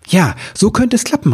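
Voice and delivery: high-pitched burst of hope; excited call. A narrator's reading. Elf hits on a rescue idea, calling out excitedly. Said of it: "rief Ribbelwitsch".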